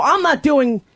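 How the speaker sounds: ordinary talk, nothing marked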